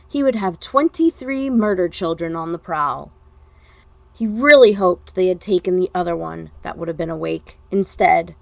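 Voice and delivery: usual speaking voice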